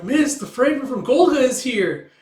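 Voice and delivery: high, loud little-creature voice; high excited call. Acted. Kippa-like voice announcing the fragment.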